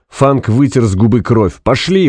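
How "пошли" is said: high-pitched urging cry; urgent shout. A narrator's reading. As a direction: shout to get them moving. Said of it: "прокричал он". (настойчивый выкрик)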